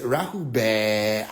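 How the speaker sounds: very low, very slow drawn-out Rahu; mock greeting. Newcomer greeting everyone, mocked deep and slow. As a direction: drag the words out gravely. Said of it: "she said".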